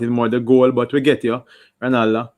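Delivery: usual speaking voice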